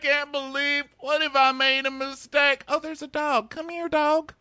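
very high, squeaky whine; hammed-up sobbing then calling a dog; mock weepy JoJo. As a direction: squeal like a tearful girl. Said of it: "she's like".

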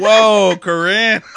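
loud, high astonished exclamation; shocked whoa. Retold or imitated. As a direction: react stunned and impressed. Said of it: "I was like".

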